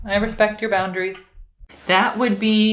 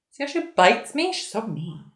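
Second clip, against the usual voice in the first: normal talking voice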